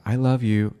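a loud cheerful I love you; bright loud I love you. Retold or imitated. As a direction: announce it with gusto. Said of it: "all these different ways to say".

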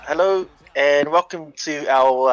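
usual speaking voice

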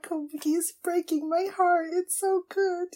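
ordinary talk, nothing marked

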